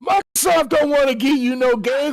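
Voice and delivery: high-pitched incredulous outburst; mock outrage. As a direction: squeal it in exaggerated disbelief.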